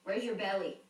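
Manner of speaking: ordinary talk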